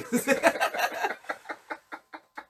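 high tipsy giggle. Real, not acted. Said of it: "I'm a little, uh, tipsy".

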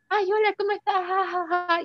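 fake cheerful mask. Hiding depression, greeting friends with a forced cheerful voice. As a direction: sing out a hollow happy hello, then fake-laugh. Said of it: "era una máscara a la gente".

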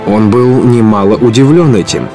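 ordinary talk

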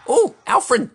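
excited. Real, not acted. Gamer cheering a big play.

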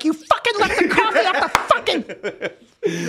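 mock angry wife. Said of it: "when she's like".

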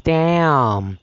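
ordinary talk, nothing marked

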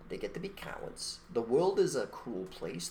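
usual speaking voice

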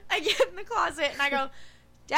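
retelling it while laughing; words bubbling with laughter; laughing through it. Retold or imitated. Retelling calling dad from the closet, laughing through it.

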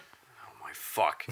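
slow voiceless whisper. Real, not acted. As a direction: whisper it in disbelief. Whispered reaction to shout.

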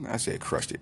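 whispered aside. Real, not acted.

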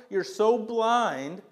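normal talking voice

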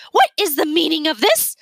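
outrage as the plan falls apart; yell. A narrator's reading. Miss Sparks yelling at the crowd, indignant. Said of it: "Miss Sparks yelled".

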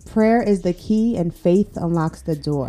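slow, emphatic delivery; deliberate repeat. Real, not acted. Repeating grandmother's saying on request, slow and deliberate. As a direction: let each part land, slowly. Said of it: "say that again".

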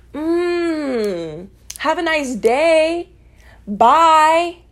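sing-song fake-sweet bye; sarcastic sweet goodbye. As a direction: wave them off, sugary. Mock sweet goodbye to haters.

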